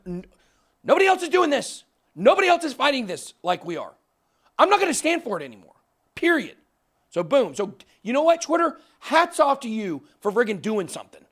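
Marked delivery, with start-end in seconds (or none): 0.8-6.6 high, loud, fast and defiant; angry rant. Real, not acted. Host raging at impersonators to his audience, loud and high. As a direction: fire it at the scammers, nobody else will. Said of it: "I get so pissed at this stuff".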